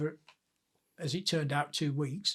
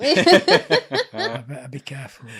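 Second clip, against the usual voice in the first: slow, laughing aside; 2 semitones lower, 10 dB louder, slower than usual. Real, not acted. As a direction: laugh through the words.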